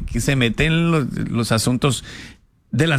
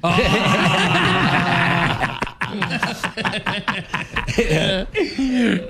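long laugh trailing off; 2 semitones higher than usual. Real, not acted. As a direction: laugh it out freely. Laughing after the story punchline.

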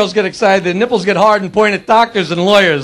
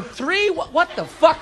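high, softer puzzled muttering; baffled audience member; 5 semitones higher, 7 dB quieter, slower than usual. Retold or imitated. Baffled audience member walking out, puzzled and quieter. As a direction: puzzle it over under your breath. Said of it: "you see 'em walking out going".

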